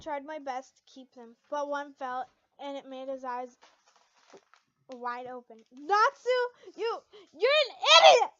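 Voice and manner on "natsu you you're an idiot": loud, high tearful yell; furious shout. Acted. Lucy shouting at Natsu after slapping him, furious yell. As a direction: scream it at him with all your might. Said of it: "After shouting with all my".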